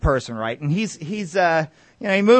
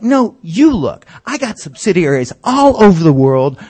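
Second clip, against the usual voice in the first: loud retort; 8 dB louder than usual. Retold or imitated. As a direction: cut him off loudly. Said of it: "He's like".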